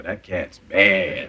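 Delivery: "bad" drawn out long and slow; admiring stretched BAD. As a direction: stretch "bad" out, meaning formidable. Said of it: "How you mean bad?".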